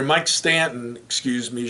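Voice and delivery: usual speaking voice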